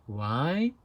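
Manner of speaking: ordinary talk, nothing marked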